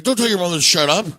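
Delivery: loud, high, rushed telling-off; indignant scolding. Retold or imitated. Friend defending someone's mother, indignant and loud.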